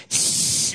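unvoiced hissing trickle sound; imitated hiss of pee. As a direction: hiss it through the teeth like a weak stream. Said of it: "started going".

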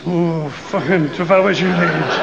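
put-on leering old man. Pervy teacher leering at the swimming boys.

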